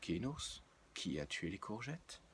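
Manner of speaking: hushed, grave detective voice; a hint of Sherlock Holmes gravity. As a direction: ask like a solemn detective. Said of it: "un ton à la Sherlock Holmes".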